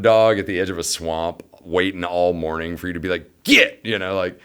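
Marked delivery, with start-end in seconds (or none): 3.4-3.8 high, loud one-word call to a dog; sharp command. Retold or imitated. Hunter releasing bird dog, sharp loud call. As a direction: snap it like releasing a hunting dog.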